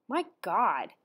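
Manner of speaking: ordinary delivery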